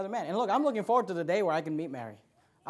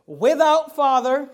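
slow, loud, raised proclamation; emphatic scripture reading; 5 semitones higher, 8 dB louder, much slower than usual. Acted. Preacher showing what Hebrews says, slow loud proclamation. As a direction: stretch out each word and project it.

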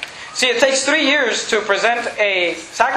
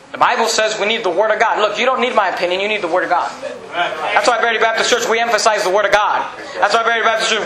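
ordinary delivery

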